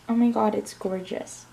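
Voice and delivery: ordinary talk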